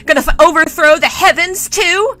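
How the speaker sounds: loud, high spoiled prince in a fury; raging tirade. Acted. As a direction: rant indignantly, building to a yell. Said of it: "still rampant and raging".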